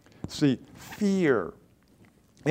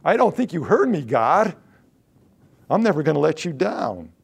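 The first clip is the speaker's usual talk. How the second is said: louder and higher, insisting hard; angry protest; 4 semitones higher, 6 dB louder than usual. Retold or imitated. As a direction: protest loudly to God, indignant. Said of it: "He gets angry with the Lord here".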